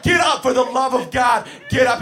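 loud, high pleading shout; desperate yelling. Retold or imitated. Counselor begging a child to get off the ice, desperate yelling. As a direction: beg at the top of your voice.

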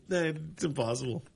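usual speaking voice

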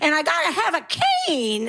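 imitating young comics playing a granny; mock old lady. Young comics performing an old lady on stage, hammed-up. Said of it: "they perform an old lady".

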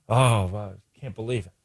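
low, soft mock moan of disbelief; a hushed, hammed-up sigh of self-pity; mock Dan Quinn lament. Host mocks the coach's press conference, soft and low mock lament. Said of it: "Dan Quinn".

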